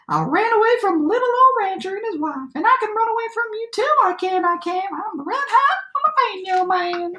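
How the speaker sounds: high-pitched gingerbread cowboy voice; boastful cowboy shout. A narrator's reading. Gingerbread cowboy brags to the animal chasing him, high boastful shout. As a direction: crow it high, like a bragging runaway. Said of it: "he shouted".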